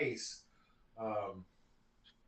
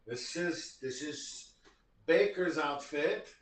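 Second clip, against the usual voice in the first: put-on Eastern European accent; slower than usual. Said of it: "less of a Eastern European".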